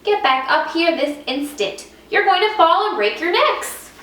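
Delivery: mother bird shouting at her babies; scolding mother bird. Acted. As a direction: scold the chicks in a panic.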